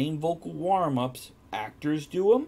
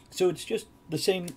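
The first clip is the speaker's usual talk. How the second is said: ordinary delivery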